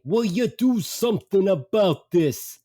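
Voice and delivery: slow, high, put-on dad voice; exasperated dad. Dad to son about the mother in back, exasperated. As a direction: imitate a father loudly fed up, drawn out. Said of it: "My dad says to me".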